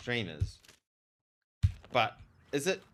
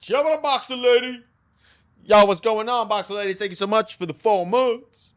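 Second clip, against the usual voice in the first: much higher, loud hype greeting; excited shoutout; 10 semitones higher, 11 dB louder than usual. Real, not acted. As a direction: shout thanks to the subscriber.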